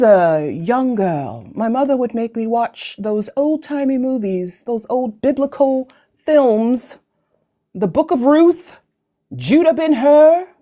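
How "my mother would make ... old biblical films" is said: normal talking voice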